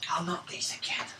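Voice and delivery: whispered, weary groan. Real, not acted. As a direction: breathe it out wearily.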